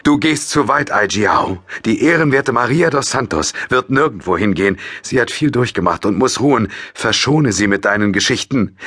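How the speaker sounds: voiced older man, stern. A narrator's reading. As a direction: put her in her place. Older man reprimands girl.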